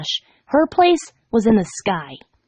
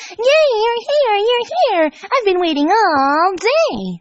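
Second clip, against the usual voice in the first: high, bouncy and thrilled; bubbly Pinkie voice; 10 semitones higher, slower than usual. A narrator's reading. Pink pony greeting her friend, high and bouncy. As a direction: squeal it like an overexcited pink pony.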